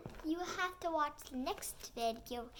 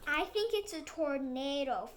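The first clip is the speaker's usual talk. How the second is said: trembling voice; 9 dB louder than usual. Acted. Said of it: "with a trembling voice".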